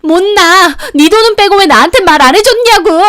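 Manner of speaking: high, loud furious refusal; enraged screaming. A narrator's reading. Youngest sister-in-law holding on to the hair, enraged screaming. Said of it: "소리 지르고".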